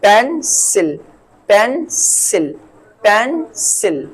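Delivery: पेंसिल split slowly as pen, then sil; syllable by syllable. Shown as a demonstration. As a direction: leave a gap inside पेंसिल: pen... sil. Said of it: "इसके दूसरे example की".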